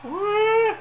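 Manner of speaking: high loud yelp; startled yelp. Real, not acted. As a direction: yelp in surprise. Startled in game.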